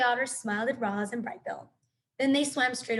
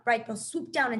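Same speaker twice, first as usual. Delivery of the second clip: ordinary delivery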